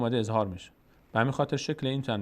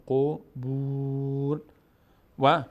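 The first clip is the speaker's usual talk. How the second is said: slow, drawn-out chanted Arabic recitation; slow Quran recitation; much slower than usual. Shown as a demonstration. Teacher slowly reciting the verse for the student, chanted. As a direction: stretch every syllable as in tajweed. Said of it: "teacher recites".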